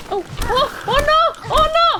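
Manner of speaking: high startled yelp, loud; panicked shout. Real, not acted. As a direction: shriek in alarm. Sudden danger in game.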